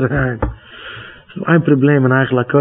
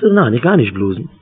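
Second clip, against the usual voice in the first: ordinary talk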